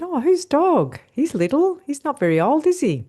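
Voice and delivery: high, sweet, coaxing voice, pitched well up; imitating her kind mother. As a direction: sweet-talk the man like a kindly mother would. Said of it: "my mother came out in me".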